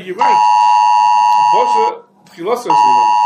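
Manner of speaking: loud, emphatic declaration of the ruling; loud acted reply to the questioner; loud retort. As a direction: call it out as a triumphant answer. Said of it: "you come along and say".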